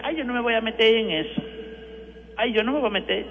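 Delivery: whiny put-on voice of someone dodging trouble; imitating a timid churchgoer saying no; mock reluctant parishioner. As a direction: mimic a person who won't get involved. Priest mimics people avoiding denunciation.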